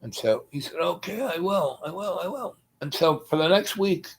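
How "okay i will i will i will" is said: imitating dad's weak agreement. Dad voiced, agreeing.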